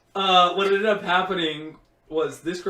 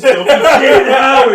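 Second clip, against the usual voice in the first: laughing shock; 7 semitones higher, 15 dB louder than usual. Retold or imitated. Friend reading memorial page.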